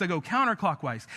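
usual speaking voice

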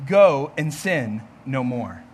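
low, slow and gentle; gentle Jesus. Acted. Jesus gently dismissing the forgiven woman, low and slow.

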